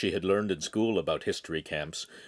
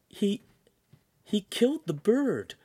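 much higher, stunned stammer; dazed girl; 13 semitones higher than usual. Acted. Shamira telling the signora what she saw, stunned. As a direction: still in shock from the chopping.

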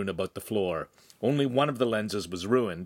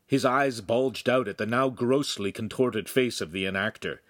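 plain reading voice

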